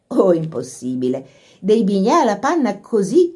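ordinary delivery

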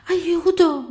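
high, loud cry for help; grido di aiuto. A narrator's reading. (grido acuto d'aiuto)

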